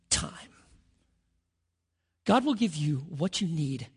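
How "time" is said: whisper. Acted. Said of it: "whispered ending".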